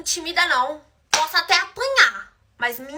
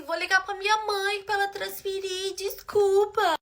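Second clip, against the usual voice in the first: high, soft whiny apology; mock tearful pleading; 5 semitones higher, 7 dB quieter, slower than usual. Crying girl promising her mother will pay, mock tearful. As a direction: imitate her whimpering apology. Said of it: "Começou a chorar".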